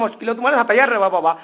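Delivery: ordinary talk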